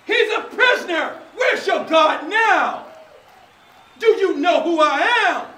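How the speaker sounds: high, loud gloating tyrant; King Herod. Acted. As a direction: lord it over the prisoner. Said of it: "being annihilated by King Herod".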